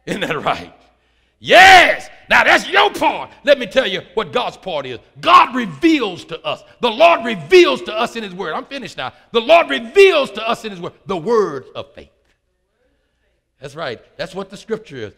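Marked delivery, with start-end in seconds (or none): 1.4-8.5 loud, raised preacher's voice from an opening shout; emphatic, high pulpit proclamation; full-force preaching. Acted. 9.3-12.1 loud, raised preacher's voice from an opening shout; emphatic, high pulpit proclamation; full-force preaching. Acted.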